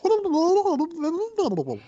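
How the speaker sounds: high babbling gibberish noise; quick mocking babble, far above your own pitch; mock Spanish gibberish. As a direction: rattle off nonsense syllables like a foreign tongue. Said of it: "be like".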